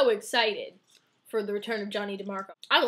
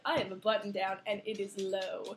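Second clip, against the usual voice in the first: mock sultry; 3 semitones lower, 6 dB quieter than usual. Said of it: "he's like".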